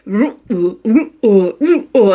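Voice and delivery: hollow dog retching noises, rising and falling; imitated dog heaving. Owner imitating Dwight heard from upstairs, dog heaving noises. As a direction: mimic a dog about to be sick. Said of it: "I start hearing Dwight".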